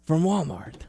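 a hint of a stern boss questioning; lightly voiced boss interrogating; playing Santino. Santino questioning Emma in improv, lightly stern. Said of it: "role playing with you being you being Santino".

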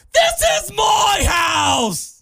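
high, slow drawn-out shouted catchphrase; co-host quotes Paige's ring entrance; imitating Paige. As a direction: belt it like a wrestler's entrance. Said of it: "What Paige said was".

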